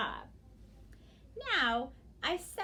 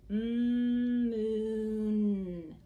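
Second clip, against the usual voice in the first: "Mm, moon" stretched out, louder; said slowly; 5 dB louder than usual. Shown as a demonstration. Teacher shows children slow sounding-out, "Mm, moon" drawn out. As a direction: hold the hum of "Mm" into a long "moon".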